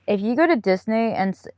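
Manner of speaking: usual speaking voice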